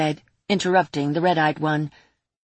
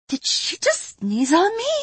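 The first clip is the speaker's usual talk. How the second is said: much higher squeaky disbelief; high-pitched voice; 12 semitones higher than usual. Acted. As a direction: squeak it out in surprise. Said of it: "in a high-pitched voice".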